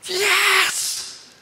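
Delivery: high, exultant cheer from heaven. Retold or imitated. Heaven's witnesses cheering the believer, high and exultant. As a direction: shout the yes like the crowd of heaven. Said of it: "They say".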